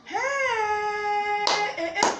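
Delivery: high, long-held cry of shock; aghast, stretched exclamation; scandalised exclamation. Acted. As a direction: let out a drawn-out, high-pitched outcry. Scandalised by the confession.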